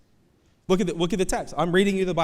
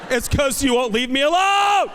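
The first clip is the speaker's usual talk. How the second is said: high, loud fed-up retort; exasperated yell; 12 semitones higher, 7 dB louder than usual. Retold or imitated. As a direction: yell it as a pestered dad. Exasperated dad's retort to son.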